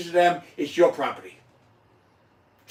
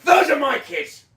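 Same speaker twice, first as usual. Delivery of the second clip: loud, much higher shout; real shout; 9 semitones higher, 5 dB louder than usual. Real, not acted. Father shouting out to his daughter Laura. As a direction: call it out to the world.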